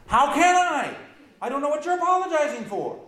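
loud, high exasperated retort; exasperated shout. Retold or imitated. To the one apologizing, a loud high exasperated retort. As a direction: throw it back loud and high, baffled. Retold reply, much louder higher.

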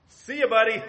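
loud, high, cheeky goodbye; mocking farewell. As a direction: toss it off like ditching your brother. Brothers abandoning their brother.

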